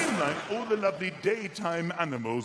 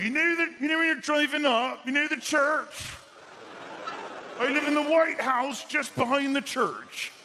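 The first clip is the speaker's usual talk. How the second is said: chatty villager; 9 semitones higher, 4 dB louder than usual. Retold or imitated. Villager explaining where they live, high and chatty. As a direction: sing out the directions like a keen local. Villager giving directions voiced.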